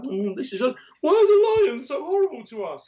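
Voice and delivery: a put-upon wildebeest complaining about the lions; whining wildebeest. Pretend. A wildebeest at the lake whines about lions, high and laughing.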